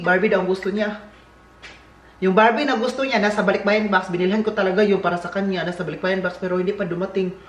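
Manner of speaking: usual speaking voice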